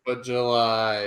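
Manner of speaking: slow laughing shout. Real, not acted. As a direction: blurt it out through a laugh.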